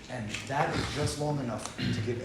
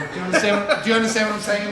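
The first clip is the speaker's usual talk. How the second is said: louder, higher, pressing the question; emphatic pleading; 5 semitones higher, 9 dB louder, faster than usual. Real, not acted. Urging the group to understand, loud and pressing. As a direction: drive it home to them.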